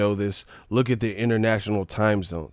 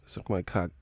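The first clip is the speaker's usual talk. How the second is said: whispered "Suck my cock" unvoiced; 4 semitones lower, 6 dB quieter than usual. Shown as a demonstration. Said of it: "same words whispered".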